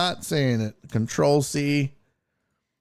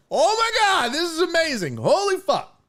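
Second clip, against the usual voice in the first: high excited gush of delight; amazed; 13 semitones higher than usual. Retold or imitated. Retelling first taste, amazed high squeal. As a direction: squeal it like the best bite ever. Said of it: "and I was like".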